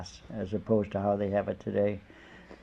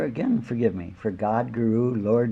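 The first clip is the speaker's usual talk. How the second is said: normal talking voice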